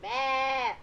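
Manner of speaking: loud imitated sheep baa; sheep bleat. Imitating a camper bleating at sheep, sheep bleat. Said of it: "He's going".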